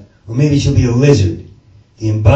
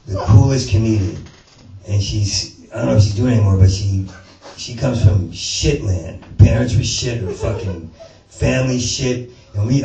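usual speaking voice

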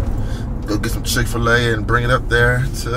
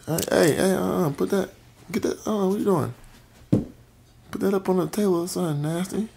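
high, softer hurried calling; 8 semitones higher, 7 dB quieter than usual. Real, not acted. Dad stopping his toddler, high and hushed. Stopping child eating off floor.

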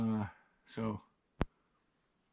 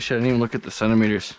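ordinary talk

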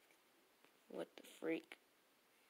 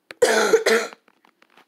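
loud cough; 4 semitones higher, 16 dB louder than usual. Real, not acted.

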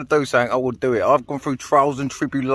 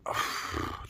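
emotional sigh; 14 dB quieter than usual. Real, not acted. Overwhelmed after the catch.

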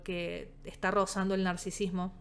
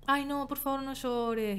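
mock pleading; 6 semitones higher than usual. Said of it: "hay gente que te dice".

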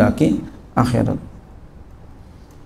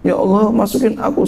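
pleading prayer; 9 semitones higher, faster than usual. Retold or imitated. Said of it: "Siang malam dia berdoa".

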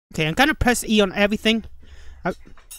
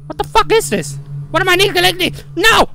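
panicked; 9 semitones higher, 5 dB louder than usual. Real, not acted. Panicked at the monster.

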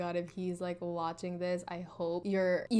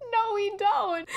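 laughing; 11 semitones higher, 5 dB louder, slower than usual. Retold or imitated. Embarrassed answer to mom, laughing.

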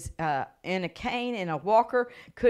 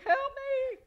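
high tiny squeak; squeaky help me. Retold or imitated. Preacher voicing the little man trapped in the chair. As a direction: play the little man crushed under her.

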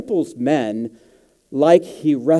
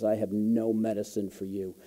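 mild tearful quote; 3 semitones lower, 9 dB quieter than usual. Retold or imitated. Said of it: "he begins to weep and he says".

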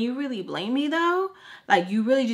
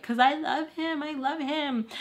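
high, whiny mock pleading; higher, softly wailed devotion; lovesick whine; 6 semitones higher, 3 dB quieter than usual. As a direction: imitate a lovesick kid protesting. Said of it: "I'm just like".

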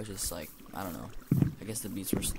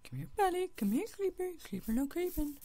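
put-on much higher sing-song baby talk; high coaxing voice; 3 dB quieter than usual. Gamer coaxing the creeper like a pet, high and cooing. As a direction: coo at it like a pet.

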